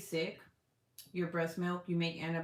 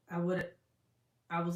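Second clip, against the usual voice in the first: ordinary delivery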